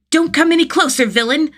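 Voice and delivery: put-on high, loud child's heroic shout; a kid yelling like a superhero; small boy shouting. A narrator's reading. Small boy warns the villain, shouting. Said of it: "the small boy shouts".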